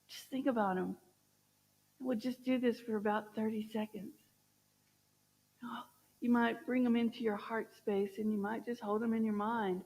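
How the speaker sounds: soft, hushed meditation guidance; soft guided visualization. Acted. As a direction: gently guide them to picture a loved one. Leads a short visualization.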